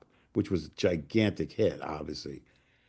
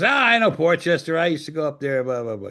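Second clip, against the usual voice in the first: higher and louder, an eager retold reply; mock excited recognition; 6 semitones higher, 4 dB louder than usual. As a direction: play Marty's boss warming up to the hometown. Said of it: "He goes".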